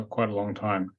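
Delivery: usual speaking voice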